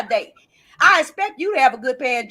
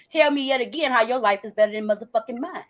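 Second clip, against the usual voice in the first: ordinary talk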